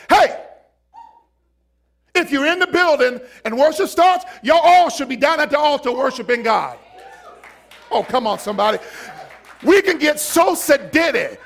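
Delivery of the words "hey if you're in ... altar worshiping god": shouting call. Pretend. Pastor shouting to the congregation to come worship. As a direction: rouse everyone down to the altar. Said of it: "I just wanna get up and say".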